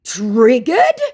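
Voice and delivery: high, indignant disbelief; mock triggered scammer. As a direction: echo it like an offended scammer. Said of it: "my now very triggered scammer".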